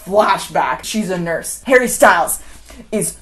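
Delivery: ordinary talk, nothing marked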